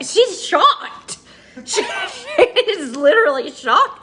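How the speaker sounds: high, loud talk through laughter; laughing. Real, not acted. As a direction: laugh helplessly while getting the words out. Laughing at friend's shocked look.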